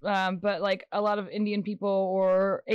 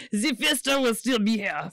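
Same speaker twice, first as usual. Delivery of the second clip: put-on German accent. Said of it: "I give everybody a German accent".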